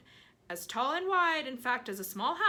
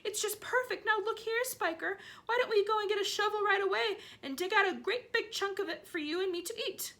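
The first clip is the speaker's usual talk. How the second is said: greedy; 13 semitones higher, 4 dB louder than usual. Acted. Greedy aunt urging digging.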